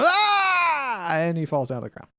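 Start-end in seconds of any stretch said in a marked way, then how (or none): 0.0-1.1 long high wail of pain; acted cry of agony; Prelion's pained scream. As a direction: let out a drawn-out, high-pitched howl. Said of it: "you hear Prelion go".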